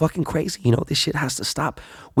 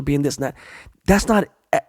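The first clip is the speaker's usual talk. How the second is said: normal talking voice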